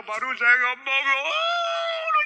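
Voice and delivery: loud wail climbing very high; hammed-up childish bawling; crying child. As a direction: burst into a high mock wail. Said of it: "dia tangis".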